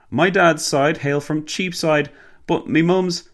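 high-pitched, slow Cockney driver. A narrator's reading. Driver telling his passenger of his London family, in Cockney. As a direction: lay on a Cockney accent. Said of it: "didn't sound very Cockney at all".

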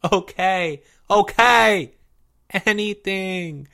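high, desperate pleading voice; tearful giving in. A narrator's reading. Blubbering boy giving in to Leo, pleading. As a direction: agree to everything in a blubbering wail.

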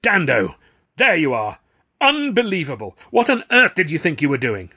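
loud, high, outraged telling-off; furious. A narrator's reading. Star confronting a colleague after the show, furious. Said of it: "was furious".

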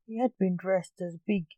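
ordinary delivery, nothing marked

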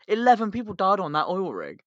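usual speaking voice

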